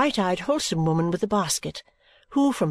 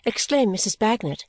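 ordinary delivery, nothing marked

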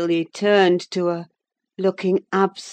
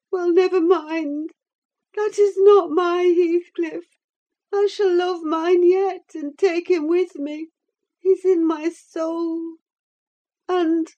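put-on high, yearning young woman voice; feverish young woman; 12 semitones higher, 5 dB louder than usual. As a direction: plead it like a dying heroine. Catherine voiced in read novel.

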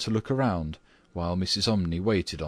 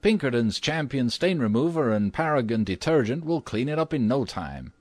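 high, emphatic salesman-like boast; acted eager young man, pitched up; confident cry; 6 semitones higher, 3 dB louder than usual. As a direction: reel off the product names with brash confidence. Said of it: "cried Washington Otis".

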